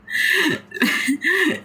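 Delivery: high bright laugh. Real, not acted. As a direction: laugh at yourself. Laughing at own joke.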